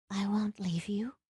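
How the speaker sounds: whispered promise; murmured. A narrator's reading. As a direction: breathe the promise. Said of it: "she murmured".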